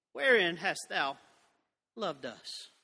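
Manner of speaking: mock their cheeky question under your breath; audacious retort. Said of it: "they have the audacity to say".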